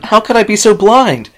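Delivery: high, loud, anguished yell; yelled in despair and anger. Acted. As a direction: shout at herself in despair. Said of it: "Rainbow Dash yelled in despair and anger".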